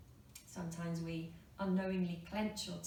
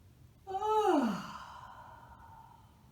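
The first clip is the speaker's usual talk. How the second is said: big yawning sigh; 13 semitones higher, 7 dB louder than usual. Shown as a demonstration. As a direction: let a huge yawn-sigh out. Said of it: "Sometimes one yawn is not quite enough".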